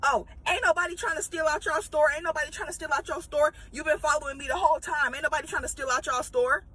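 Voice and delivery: mock angry customer. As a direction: fire it back like you're offended to be watched. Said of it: "she immediately gets mad".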